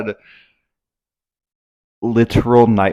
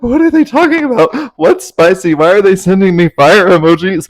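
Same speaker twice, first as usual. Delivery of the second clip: much higher, louder put-on confused voice; baffled high voice; 10 semitones higher, 8 dB louder than usual. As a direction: play your baffled past self, pitched way up. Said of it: "And I was like".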